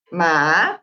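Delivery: "ma" starting low and sweeping upward; drawn-out "ma" with a rising tone; tono chino. Shown as a demonstration. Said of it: "espero haberlo pronunciado bien". ("ma" alargado que baja y luego sube)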